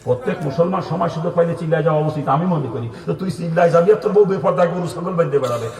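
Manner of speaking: ordinary talk, nothing marked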